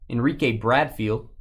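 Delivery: usual speaking voice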